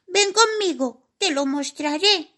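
high squeaky mouse beckoning; little mouse voice. Acted. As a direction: urge her to follow. Mouse leads the fairy.

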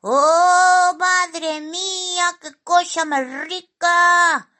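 high, loud cartoonish rapture; squealing with relish; greedy delight. A narrator's reading. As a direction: draw it out in high-pitched bliss over food. Simón relishes the cake.

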